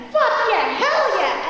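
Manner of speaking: loud, high football cheering; yelling. Retold or imitated. Instructor cheers the football game in the car, loud yelling. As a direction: cheer the touchdown at the top of your lungs. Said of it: "a guy who's yelling at me".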